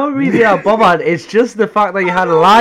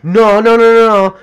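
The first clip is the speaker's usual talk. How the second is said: loud, higher string of retold noes; mock protest; 5 semitones higher, 7 dB louder than usual. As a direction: imitate someone hurriedly backtracking, raised voice. Imitates her hasty change of mind.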